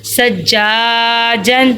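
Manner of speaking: سجاجن with a long "jaa", short "jan"; lengthened middle vowel. Shown as a demonstration. Teacher modelling the lengthening rule, drawn-out middle vowel. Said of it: "اس کو ہم لمبا کرتے ہیں".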